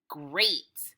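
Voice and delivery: ordinary talk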